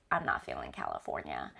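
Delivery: a hint of a low, casual husband voice; lightly voiced husband. Quoting her husband's news to viewers, light low imitation. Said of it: "Maybe he just said".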